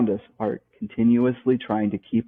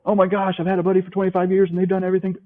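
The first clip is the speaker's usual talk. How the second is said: imitating a whiny friend; 8 semitones higher, faster than usual. Quoting a hesitant friend.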